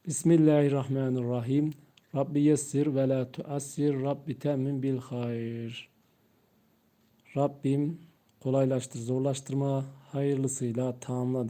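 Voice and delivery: quiet, unhurried dua; soft slow opening prayer. Real, not acted. Teacher opens the lesson with a soft, slow prayer. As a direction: pray it softly before the lesson begins.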